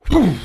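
high, loud "poof"; puff of relief. Acted. Bear relieved to be under the trees, loud puff. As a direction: blow out a sudden puff.

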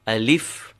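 Alif stressed on the final lif; light A, then lean on lif in Alif; stress on second syllable. Shown as a demonstration. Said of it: "not emphasize the second syllable".

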